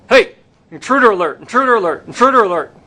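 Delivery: alarm call. Acted. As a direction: call out the alert. Guard raises the alarm.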